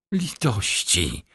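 slow, drawn-out plea for mercy; faint, dazed cry, not shouted; half-conscious plea. A narrator's reading. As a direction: beg weakly, stretching the word. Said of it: "zawołał blady i półprzytomny".

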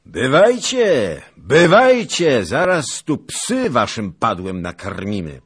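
bellowed jeer, easing slightly at the end; soldiers' shouted taunt. A narrator's reading. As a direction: holler a challenge across to the enemy. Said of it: "wolali książęce żołnierze".

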